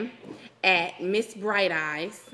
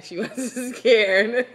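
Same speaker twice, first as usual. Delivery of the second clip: laughing; 2 semitones higher, 5 dB louder, slower than usual. Real, not acted. Teasing laugh at unscared listener.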